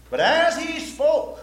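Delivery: slow loud reading. Real, not acted. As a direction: announce the verse like a proclamation. Preacher rereading verse.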